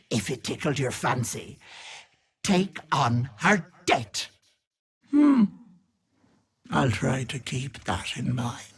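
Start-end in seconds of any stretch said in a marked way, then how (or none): none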